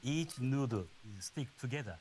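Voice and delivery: normal talking voice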